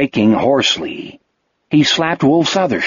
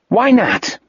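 angry; 8 semitones higher than usual. A narrator's reading. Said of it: "Jack looked at him angrily".

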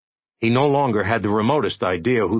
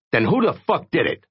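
demanding; 7 semitones higher than usual. Acted. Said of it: "Jay demanded".

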